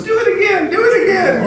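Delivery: put-on egging the kid on like a circus act; delighted demand. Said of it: "he goes".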